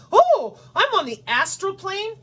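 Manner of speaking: high, sing-song mock voice; mocking believers. Mimicking spiritual believers to viewers, high mocking voice. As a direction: parody them in a high airy tone. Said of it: "And every single one of you".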